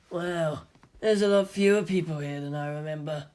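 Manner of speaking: lightly voiced rough schoolboy; a hint of a tough boy, dry; Toji's voice. A narrator's reading. Toji's voice in the story.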